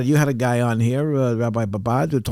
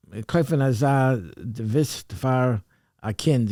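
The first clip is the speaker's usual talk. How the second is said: slow, word-by-word Yiddish reading; halting Yiddish reading; slower than usual. Real, not acted. Reading the kol korah aloud before translating it, slow and halting. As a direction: sound out an unfamiliar Yiddish sentence slowly. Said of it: "don't know how to read Yiddish".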